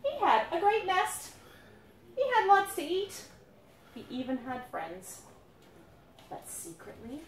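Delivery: storybook narration for little ones; reading to children. A narrator's reading. Parent reading a picture book to the children, storybook voice. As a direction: read it to the kids gently.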